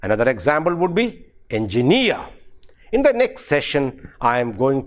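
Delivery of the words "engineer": "engineer" as engi-NEER, peak on the last syllable; stress on the suffix. Shown as a demonstration. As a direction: put the weight on the end of "engineer". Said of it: "normally on the suffix itself".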